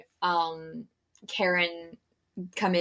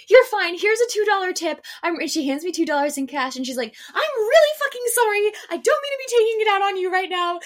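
much higher, louder apologetic rant; squeaky, fast, frazzled nice Karen; high-pitched customer; 11 semitones higher, 6 dB louder than usual. Retold or imitated. Customer apologizing to the barista at the window, high and loud. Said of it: "And she was like".

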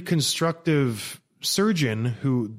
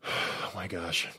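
out of breath; 7 semitones lower, 10 dB quieter than usual. Real, not acted. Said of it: "Hold on, I gotta breathe".

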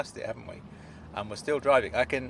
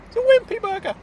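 high, loud squeaky announcement; high-pitched thrilled character voice; excited Claude; 9 dB louder than usual. Acted. Claude excited about burger.

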